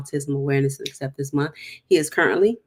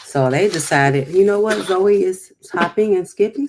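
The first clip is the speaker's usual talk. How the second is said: usual speaking voice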